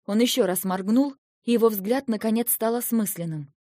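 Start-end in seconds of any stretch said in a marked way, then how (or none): none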